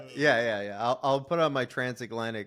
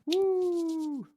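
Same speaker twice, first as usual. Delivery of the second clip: excited whoop. Real, not acted. Cheering the parlay.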